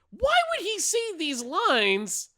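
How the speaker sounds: high, loud incredulous outburst; exasperated rant. Real, not acted. Co-host ranting about the villain's missing accent, exasperated. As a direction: raise pitch and drag out the indignant question.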